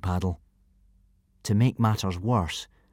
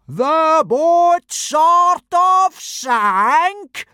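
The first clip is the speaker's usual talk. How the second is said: very slow, much higher and louder roar; 8 dB louder, much slower than usual. Acted. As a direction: roar the words back in outrage. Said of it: "roared Gobber".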